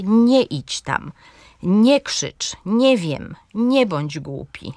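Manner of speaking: "Nie idź, nie krzycz, nie wiem, nie bądź" each stressed on NIE, the verb attached; slow examples leaning on each "nie". Shown as a demonstration. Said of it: "Akcent pada na ten pierwszy wyraz". (akcent na „nie”, czasownik doklejony jak druga sylaba)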